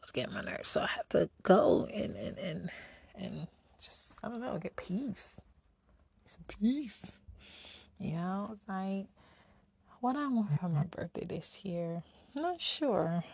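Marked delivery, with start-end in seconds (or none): 6.2-7.2 whispered. Real, not acted. Longing for peace, whispered.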